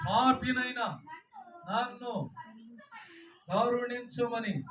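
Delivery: loud, slow, drawn-out plea; pleading sinner. Acted. Tax collector begging God, loud slow plea. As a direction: stretch పాపినైన నన్ను కరుణించుమని out as a begging cry. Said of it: "పలికిన".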